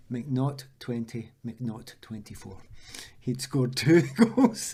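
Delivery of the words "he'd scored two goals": loud, slow, breaking into laughter; laughing punchline. Real, not acted. Footballer revealing his teammate's goals, laughing. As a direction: land the punchline through a laugh.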